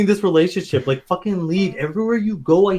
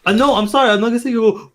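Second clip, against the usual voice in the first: normal talking voice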